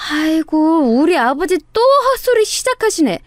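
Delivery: high, put-on daughterly lament; mock exasperation. Woman pretending the swindler is her senile father, mock exasperation. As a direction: groan theatrically at a difficult father. Feigned despair at deluded father.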